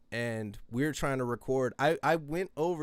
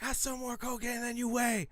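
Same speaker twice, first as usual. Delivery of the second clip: retold yelling; 10 semitones higher than usual. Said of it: "And I was yelling it".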